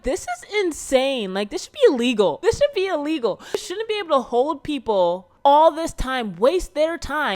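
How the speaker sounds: high, loud indignant protest; retold outrage. Dancer reacting to everyone being cut, retold indignant outrage. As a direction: protest it with rising exasperation.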